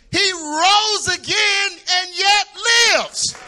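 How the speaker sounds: high, loud, rising gospel preaching; climbing shouted sermon cadence; preaching at full force. Acted. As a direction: whoop it up from the pulpit, slowing on the big words. Preaching to the congregation.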